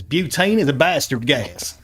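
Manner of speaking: slow, loud bad Texan accent; a hammed-up Texan drawl, slow. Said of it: "my bad Texan accent for Hank Hill".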